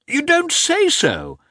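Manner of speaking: very high, drawn-out surprise; astonished exclamation. A narrator's reading. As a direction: exclaim it loudly in amazement. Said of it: "he exclaimed".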